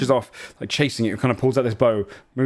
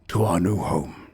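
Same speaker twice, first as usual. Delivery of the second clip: sinister vampire toast; 6 semitones lower, slower than usual. Acted. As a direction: savour it darkly, slowly. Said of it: "grabs it by the head".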